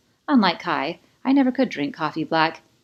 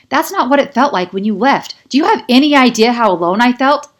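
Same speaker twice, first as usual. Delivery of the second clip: loud and high, words tumbling out; furious, hurt shout; heated outburst; 7 semitones higher, 11 dB louder than usual. A narrator's reading. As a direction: pour out the hurt like a speeding train. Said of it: "My words are a speeding train".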